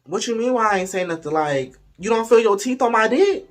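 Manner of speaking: high-pitched acted incredulity; indignant disbelief. Storyteller confronts partner about teeth, indignant disbelief. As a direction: challenge him with mock indignation.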